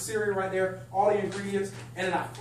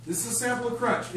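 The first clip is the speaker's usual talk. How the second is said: normal talking voice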